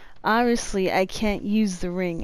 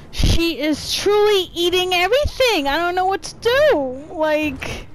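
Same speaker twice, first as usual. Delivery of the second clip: scared whining; 7 semitones higher, 3 dB louder than usual. Real, not acted. Boss eats his bullets.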